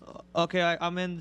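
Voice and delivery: normal talking voice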